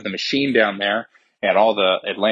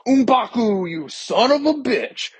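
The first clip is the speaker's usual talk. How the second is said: high, slow, put-on mock greeting; hammed-up Shuri; slower than usual. Shuri dropping in to greet M'Baku, high and hammed-up. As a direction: drag it out in a high comic voice. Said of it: "she's like".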